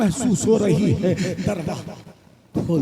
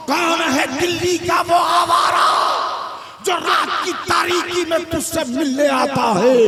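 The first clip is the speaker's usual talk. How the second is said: angry accusation; 7 semitones higher than usual. Acted. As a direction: shout the accusation at his wife. Angry husband accuses wife. (पत्नी पर गुस्से में चिल्लाना)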